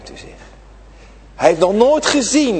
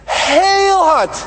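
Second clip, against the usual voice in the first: loud cry; 11 semitones higher, 3 dB louder than usual. Shown as a demonstration. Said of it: "Nou, dat doet hij".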